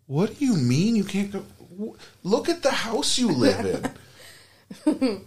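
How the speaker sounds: hammed-up indignant squawk; incredulous outburst. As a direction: protest in exaggerated disbelief. Retelling own outrage at movie.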